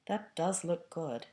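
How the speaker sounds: slow, soft, sighing longing; a ghost mourning food he can't eat; wistful, sad ghost. A narrator's reading. Ghost watching Harry cut steak, says it sadly and slowly. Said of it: "said the ghost in the ruff sadly".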